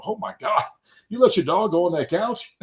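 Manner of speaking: retold incredulous gasp of a question; mock shock. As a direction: act appalled at the owner. Acting his shock at the owner.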